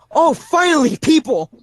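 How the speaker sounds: high, loud cry of relief, finally found someone; relieved shout. Acted. A lost stranger hails the party from the darkness, loud and overjoyed. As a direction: call out, overjoyed to see people. Unseen voice calls out gladly.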